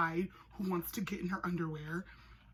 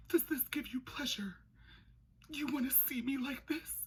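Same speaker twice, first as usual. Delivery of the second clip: melodramatic damsel; 6 semitones higher than usual. Pretend. She's all, melodramatic quote.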